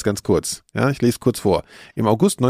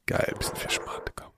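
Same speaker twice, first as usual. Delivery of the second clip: whisper; 12 dB quieter than usual. Real, not acted. Aside while pouring drinks.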